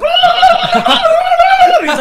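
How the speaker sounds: high laugh breaking through the words; words swallowed by laughter; laughing hard. Real, not acted. Telling a friend about the live duck, laughing.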